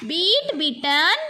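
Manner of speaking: slow, drawn-out teacher chant; measured classroom verb recitation; repeat-after-me drill. Shown as a demonstration. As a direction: stretch each word for the class to echo. Leads word repetition drill.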